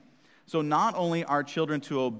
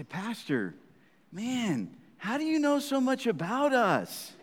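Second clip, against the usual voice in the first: put-on teen asking, amused; 10 semitones higher than usual. Congregation's imagined question.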